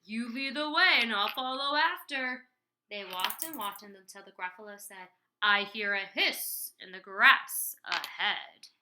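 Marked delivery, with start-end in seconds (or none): 0.0-2.5 loud, booming monster voice; big scoffing storybook creature; amused monster. Acted. Laughing Gruffalo humours the mouse. 5.4-8.7 loud, slow monster voice; big storybook creature on alert; wary monster. Acted. Gruffalo hears something ahead.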